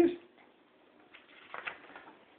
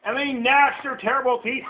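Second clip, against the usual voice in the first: higher, emphatic story reading; dramatic storytelling; 6 semitones higher than usual. A narrator's reading. Parent reading monsters to a child, higher and dramatic. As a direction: punch up "gnashed" with relish.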